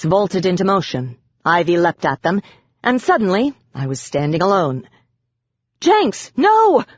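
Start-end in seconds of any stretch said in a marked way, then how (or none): 5.8-6.8 high, loud cry of alarm; frightened cry. A narrator's reading. Narrator crying out in alarm to Jenks. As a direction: cry out to stop the pixie. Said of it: "I cried out".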